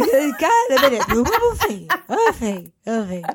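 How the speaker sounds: calling the dog through laughter; high laughing call, fading. Real, not acted.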